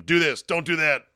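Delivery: mock bossy investor giving commands. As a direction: bark out the orders like a pushy boss. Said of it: "an active investor who's telling him".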